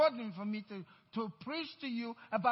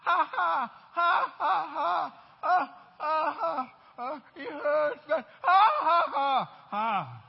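loud, higher, deliberately forced ha ha; forced laughter; 4 semitones higher, 8 dB louder, slower than usual. Shown as a demonstration. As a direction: laugh on purpose through the hurt. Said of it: "just laugh".

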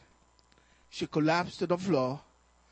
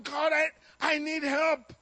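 crying out; 9 semitones higher, 4 dB louder than usual. Retold or imitated. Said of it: "So I was crying out".

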